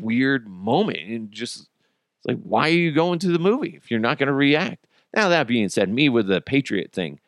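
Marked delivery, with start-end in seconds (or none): none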